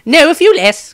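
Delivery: high, loud fussy wizard, hitting few; high and loud, stress on few; quick correction. A narrator's reading. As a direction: snap back with the correction. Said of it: "corrected Maestro quickly".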